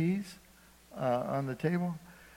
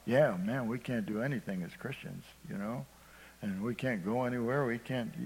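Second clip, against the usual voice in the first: softer, lower mock grumble; 3 semitones lower, 7 dB quieter than usual. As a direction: mutter the complaint like a sulky believer.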